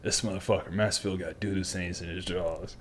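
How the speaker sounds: very quiet, low, out of the corner of the mouth; side-mouth whisper. Retold or imitated. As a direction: hush it so the drill instructor can't hear. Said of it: "I'm talking to Hess out the right corner of my mouth".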